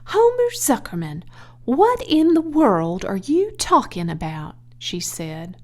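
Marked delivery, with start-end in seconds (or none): 0.0-4.5 much higher, indignant wife voice; bewildered wife. Acted. Edith questions her husband, higher and bewildered. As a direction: demand an answer, baffled.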